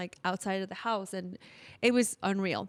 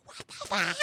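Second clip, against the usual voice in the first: urgent whisper; 4 dB quieter than usual. Retold or imitated. Whispering to girlfriends in hotel lobby.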